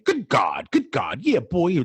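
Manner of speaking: a hint of the hype MC's catchphrase, rising on yeah boy. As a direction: lightly imitate the club MC's trademark call. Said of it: "he was known for saying".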